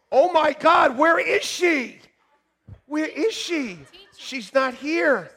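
high, slow and surprised calling out; high mock-alarmed search. Real, not acted. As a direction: look around the room, startled. Looking for a missing congregant.

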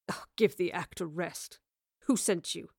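low, weary impatience; impatient sigh. Acted. Man questioning a girl he thinks is pretending, impatient. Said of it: "He sighed with impatience".

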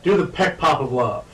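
ordinary delivery

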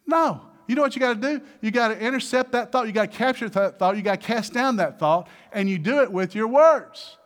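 loud, high and urgent; rally the congregation against fear; preaching at full force. Acted.

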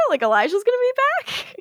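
high, fast surprised question. Retold or imitated. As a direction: blurt it out in disbelief. Haley surprised quote.

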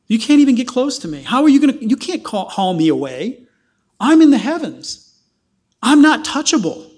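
God scoffing down at the nations; mocking God.

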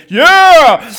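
rowdy male cheer; guys cheering. Retold or imitated. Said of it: "All the guys raise their hand".

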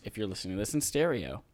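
ordinary talk, nothing marked

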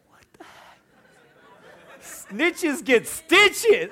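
bursting into laughter, high and loud; giggling fit over the snitch memory; laughing. Real, not acted. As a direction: laugh through the words.

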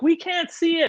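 high whiny complaint; mock whiny audience. Mocking the viewers who can't see, high and whiny. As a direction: whine it high like a frustrated viewer. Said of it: "And they're like".

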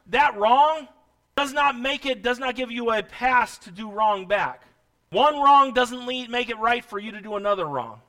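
loud, high, impassioned preaching; shouted sermon exhortation; full-force preaching. Real, not acted. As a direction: drive every phrase home to the congregation.

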